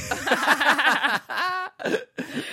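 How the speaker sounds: loud high burst of laughter; big laugh. Real, not acted. Reacts to masseuse joke.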